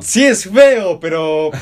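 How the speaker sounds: loud, high-pitched admission; exasperated concession. Real, not acted. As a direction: concede it loudly, ready to argue back. Conceding loudly in the argument.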